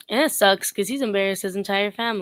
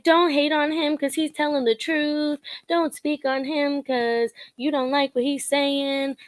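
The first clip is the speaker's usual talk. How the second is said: defending fan; 6 semitones higher, slower than usual. Retold or imitated. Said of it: "being like".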